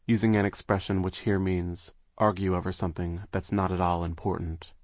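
ordinary delivery, nothing marked

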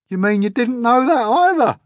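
high, loud scornful sneer. A narrator's reading. Centipede scornfully sneering at James, high and loud. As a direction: scoff at the boy. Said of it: "the centipede said scornfully".

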